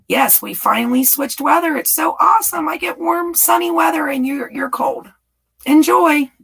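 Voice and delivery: high, excited teasing; gleeful gloating. Real, not acted. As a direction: crow about winning the weather swap. Gloating to Ed about warm weather.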